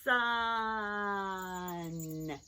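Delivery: "Sun" with a long hiss and long vowel; said slowly. Shown as a demonstration. Teacher counting sounds with helpers, very drawn-out. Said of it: "when I say it again".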